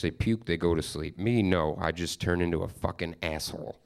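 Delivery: ordinary delivery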